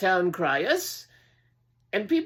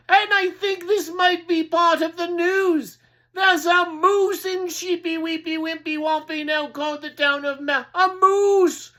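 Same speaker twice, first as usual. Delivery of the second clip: high, loud shouted announcement; crier shouting news; 9 semitones higher, 5 dB louder than usual. Acted. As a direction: cry the news to the town.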